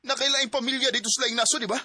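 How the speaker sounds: loud, high, demanding; angry accusation. Acted. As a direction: press her for an answer. Son accuses mother of other family.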